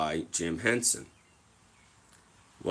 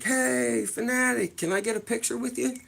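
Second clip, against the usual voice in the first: much higher, fast, hammed-up eager fan; mock eager fan; 14 semitones higher than usual. Asking the mascot for a photo, much higher and fast. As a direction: call out like a starstruck kid. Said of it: "and I'm like".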